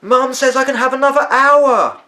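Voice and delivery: put-on a boy shouting up the stairs to his grandmother; shouted up. A narrator's reading. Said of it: "Charlie shouted up to his grandmother".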